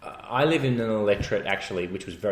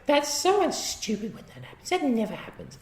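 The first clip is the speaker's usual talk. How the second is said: mocking wife; 13 semitones higher than usual. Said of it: "my wife said".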